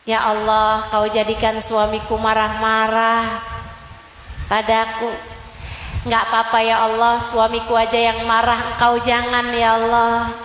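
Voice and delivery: patient wife's prayer. Acted. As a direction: pray with calm acceptance. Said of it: "Saat suaminya lagi marah-marah". (doa istri yang sabar, suara rendah)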